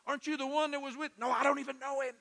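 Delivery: high-pitched challenge and hasty denial; acted denial. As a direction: act out an accusation, then a high, insistent denial. Acts out Peter's accusers and his denial.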